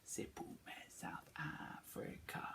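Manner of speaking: whispered echo of the phrase; whispered pupil turn. Shown as a demonstration. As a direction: whisper the reply. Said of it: "pupil's echo".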